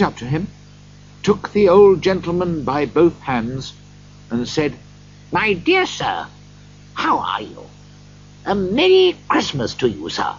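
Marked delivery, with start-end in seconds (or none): 5.3-10.3 mildly raised, hearty greeting; hearty Scrooge. A narrator's reading. Scrooge takes the old gentleman by both hands, hearty. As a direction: greet him as a dear friend.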